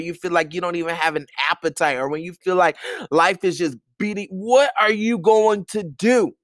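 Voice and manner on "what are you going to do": ordinary talk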